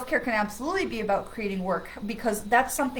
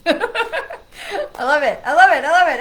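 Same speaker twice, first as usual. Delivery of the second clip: laughing; 7 semitones higher, 12 dB louder, slower than usual. Real, not acted. Delighted by friend's yelling.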